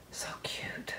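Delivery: an unvoiced, breathy aside; whisper. Real, not acted. Painter whispering in delight at the little swirl. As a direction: hush it like a secret.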